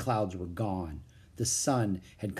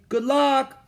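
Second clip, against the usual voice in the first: high, loud wish called after her; calling out; 13 semitones higher, 7 dB louder than usual. A narrator's reading. Annie calling good luck to the kangaroo, loud high call. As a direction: send it after her as she leaves. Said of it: "called Annie".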